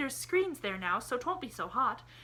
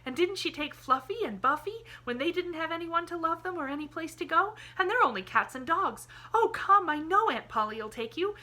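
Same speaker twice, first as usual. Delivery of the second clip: higher and louder, bursting with the idea; a girl thrilled by her plan; excited cry; 11 semitones higher, 9 dB louder than usual. A narrator's reading. Pollyanna crying out to Jimmy that Aunt Polly will take him, higher and louder. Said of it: "she cried".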